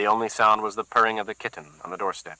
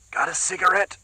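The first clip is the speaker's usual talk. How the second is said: Whitey's voice; 10 semitones higher than usual. A narrator's reading. Whitey asks thugs casually.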